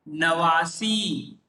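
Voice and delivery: teacher modelling नवासी slowly; slow pronunciation demo. Shown as a demonstration. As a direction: stretch नवासी out for learners. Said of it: "क्या हो जाता है?".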